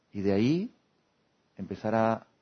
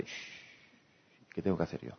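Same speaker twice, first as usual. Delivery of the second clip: whispered shh, then a low murmured question; hushed inner voice; 6 semitones lower, 10 dB quieter, slower than usual. Pretend. Telling oneself to hush and look inward, whispered. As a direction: hush yourself and ask under your breath.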